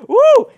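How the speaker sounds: high, loud "woo!"; excited whoop. Real, not acted. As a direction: let out a joyful whoop.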